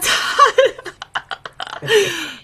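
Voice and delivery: high falling real laugh; laughing at the memory; long laugh. Real, not acted. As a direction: let a real laugh run out.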